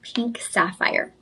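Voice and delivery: slow, soft whisper; hushed reveal. Real, not acted. Host revealing the stone after a drum roll, slow whisper. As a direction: whisper it like a secret unveiled.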